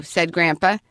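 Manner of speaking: ordinary delivery, nothing marked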